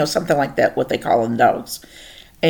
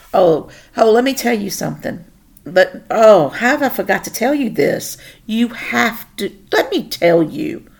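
excited; 6 semitones higher, 4 dB louder than usual. Real, not acted. Sudden excited remembering.

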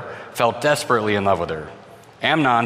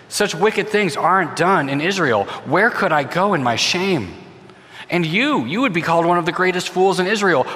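desperate pleading; 7 semitones higher than usual. A narrator's reading. Tamar pleading with Amnon.

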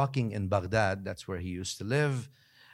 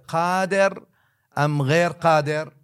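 louder and higher, slow at the end; the street drunkard demanding an answer, raised and loud; loud challenge; 6 semitones higher, 8 dB louder, slower than usual. Retold or imitated. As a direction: call it out as a challenge.

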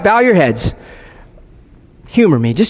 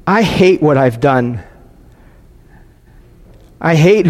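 confessional prayer; 4 semitones lower than usual. Acted. Pastor voicing a penitent's prayer to the Father, low and halting. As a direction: pray it heavily, leaving silence after each confession.

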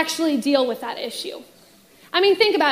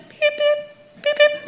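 put-on baby bird chirps; 11 semitones higher, slower than usual. Imitating baby birds chirping.

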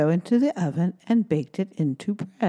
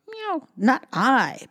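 slow, higher meowing cat; purring cat voice; 6 semitones higher, slower than usual. Acted. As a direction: purr it like a cat. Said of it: "purred the cat".

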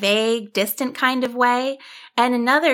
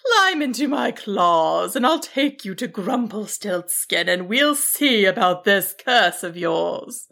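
kindly dragon. Acted. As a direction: invite as a benevolent giant. Dragon invites sheep aboard.

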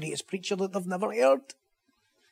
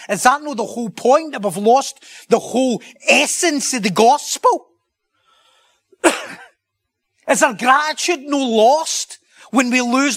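much higher and louder, impassioned preaching; preaching at full force; 8 semitones higher, 8 dB louder than usual. Acted. Preacher pressing the congregation about grace, fervent and loud. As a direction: drive the question home to the congregation.

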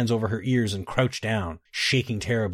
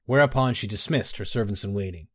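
ordinary delivery, nothing marked

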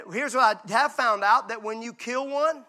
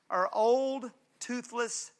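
ordinary delivery

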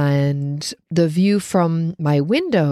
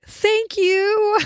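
high mock yell of thanks; yelled back. Answering a stranger's bless you across the courtyard, high mock yell. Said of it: "and I yelled back".

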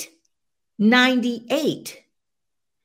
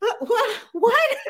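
confused what. Retold or imitated. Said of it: "And I'm like".

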